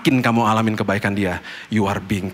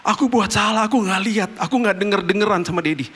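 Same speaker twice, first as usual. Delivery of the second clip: put-on child's voice; 10 semitones higher than usual. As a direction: play the sorry son, a hint of a child. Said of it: "Dia bilang".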